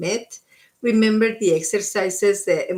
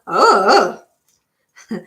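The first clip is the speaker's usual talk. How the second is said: loud cartoon dog howl of oh; mock Scooby-Doo, booming the oh; 9 dB louder than usual. Reader doing her Scooby-Doo bit for viewers, playful. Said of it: "that's my Scooby-Doo voice".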